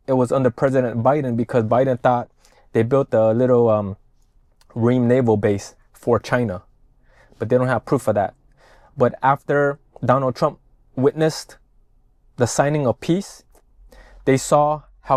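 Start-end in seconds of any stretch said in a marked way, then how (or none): none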